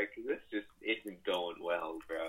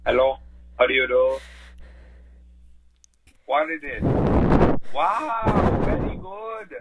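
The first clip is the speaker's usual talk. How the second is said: loud phone greeting; 2 semitones higher, 14 dB louder than usual. Pretend. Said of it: "Is that Rashad?".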